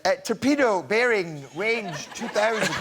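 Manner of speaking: slow, high, mincing take on the report; lightly camp up the torpedo line; effeminate delivery. Retold or imitated. Mocking the actor who got her line, slow and camp. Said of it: "was really quite effeminate".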